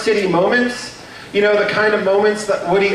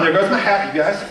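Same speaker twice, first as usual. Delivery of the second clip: ordinary talk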